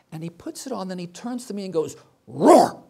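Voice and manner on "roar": loud playful little-lion roar; child's roar. Retold or imitated. Grandson roars at grandpa in mask, loud playful roar.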